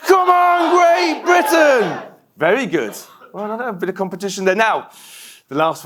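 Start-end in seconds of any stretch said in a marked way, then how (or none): 0.1-2.0 high, loud, slow stadium roar; crowd shout. Retold or imitated. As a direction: bellow it like the whole stand is cheering. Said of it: "I could also hear the crowd shouting".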